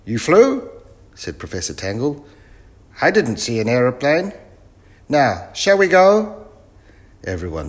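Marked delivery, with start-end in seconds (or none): 0.1-0.7 high, loud incredulous question; astonished professor. Acted. Professor mishears the children, loud high astonishment. 3.0-6.5 loud, high professor voice; eccentric professor. Acted. Professor sizes up the young crew, loud and high. Muddled professor talks to children.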